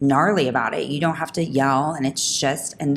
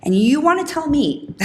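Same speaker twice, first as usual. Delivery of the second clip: high, loud disbelief; incredulous; 10 semitones higher, 4 dB louder than usual. Real, not acted. Mother challenging the doctors, rising in disbelief. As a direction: pitch it up in outraged disbelief.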